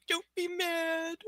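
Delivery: a light, slow pleading puppy voice; pouting puppy voice. Retold or imitated. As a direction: voice the dog begging not to be scolded, drawn out. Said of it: "starts literally pouting at me, going".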